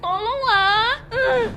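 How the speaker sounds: high, loud sobbing cry for help; crying plea. Acted. Locked up, begging for help. (memohon sambil menangis keras)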